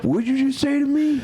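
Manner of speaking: high, outraged challenge; mock outraged. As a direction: pretend outrage at coworker. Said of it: "all of them at work will go".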